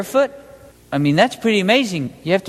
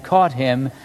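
ordinary delivery

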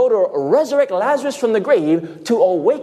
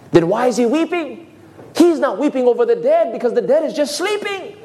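ordinary delivery

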